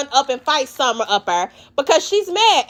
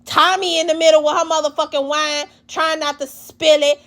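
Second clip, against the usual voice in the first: usual speaking voice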